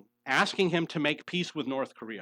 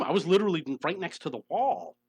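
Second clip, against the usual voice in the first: normal talking voice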